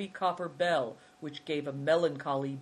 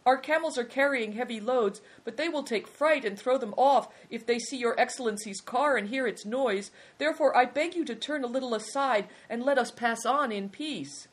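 high, insistent plea; urgent, high-pitched begging; pleading; 9 semitones higher, 5 dB louder than usual. A narrator's reading. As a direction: implore loudly in a high voice. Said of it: "pleaded the man".

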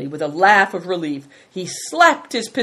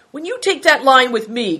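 higher, louder angry retort; indignant, raised voice; trembling with anger; 8 semitones higher, 6 dB louder than usual. A narrator's reading. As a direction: cry it out in anger. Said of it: "his voice trembling with anger".

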